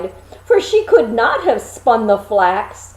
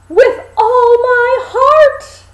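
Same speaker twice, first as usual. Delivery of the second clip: much higher, louder and slow, bursting with relief; eager girl; 13 semitones higher, 7 dB louder, slower than usual. A narrator's reading. Girl greeting the three helpers, high and overjoyed. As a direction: cry it out in joyful relief.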